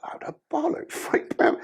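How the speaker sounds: high, whiny mock complaint; moaning mates. Mates moan to him about three pounds, whiny. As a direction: sneer at the tiny haul. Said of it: "The other two went".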